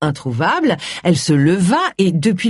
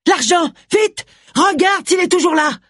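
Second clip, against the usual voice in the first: much higher, urgent cries; panicked urging; 12 semitones higher than usual. A narrator's reading. The mother urges her daughter to check the money, frantic and high.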